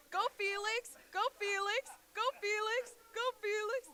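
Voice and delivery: loud high chant of Go Felix; cheering. Real, not acted. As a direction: hype him up to hurry. Cheering player on, rushing.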